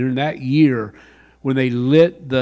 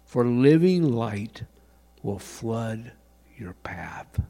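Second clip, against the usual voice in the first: quieter, slower, fading away to a whisper on your path; soft, slow reading; 2 semitones lower, 4 dB quieter than usual. Shown as a demonstration. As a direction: let each word sink in. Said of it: "Let that sink in for a moment".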